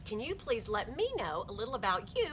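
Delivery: normal talking voice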